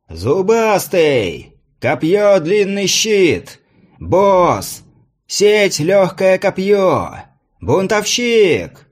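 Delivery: high, slow, drawn-out announcer call; herald calling out. A narrator's reading. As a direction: stretch each name out across the arena. Herald announces fighters in arena.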